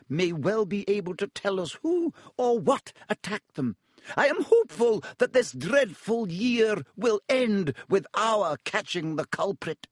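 high crisp teacher announcement; stern teacher. A narrator's reading. McGonagall announcement voiced.

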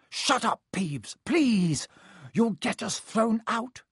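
high, anxious hushing plea; urgent pleading. A narrator's reading. Pupils begging Peeves not to expose them, pleading. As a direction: beg him to stop, pitched high.

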